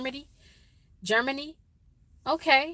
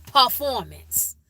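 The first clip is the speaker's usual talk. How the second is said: performance as slow Chicago "pah-formance"; drawn-out Chicago performance without the first R; Chicago accent; 2 semitones higher, 4 dB louder, slower than usual. Shown as a demonstration. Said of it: "We say P A H".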